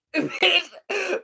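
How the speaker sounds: a short high burst of laughter; laughing. Real, not acted. Reviewer laughs at the patient's antics, bursting out. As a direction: laughs out loud at the show.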